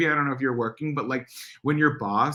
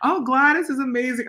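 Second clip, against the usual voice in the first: mock-gushing, much higher; 13 semitones higher, 3 dB louder than usual. Mocking people who praise grandma, high gushing voice. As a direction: parody how people rave about her. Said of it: "People are always like".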